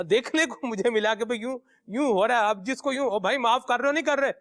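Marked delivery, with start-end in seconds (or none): 3.1-4.3 higher, fast, pushy demand for forgiveness; bullying apology. Retold or imitated. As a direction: imitate a thug demanding you forgive him. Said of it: "یہ بھی انداز معافی کا".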